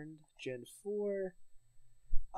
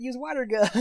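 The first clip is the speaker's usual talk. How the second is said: repeated louder and higher; louder repeat, higher pitch; 8 semitones higher, 6 dB louder than usual. Retold or imitated. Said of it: "I said".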